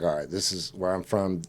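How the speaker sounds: low, hushed, controlled menace; quiet threat. Retold or imitated. Warning the roommate he just hit, quiet threat.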